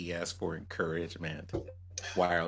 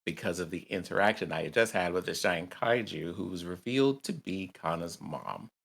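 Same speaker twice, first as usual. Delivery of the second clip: ordinary delivery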